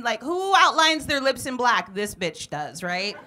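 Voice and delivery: usual speaking voice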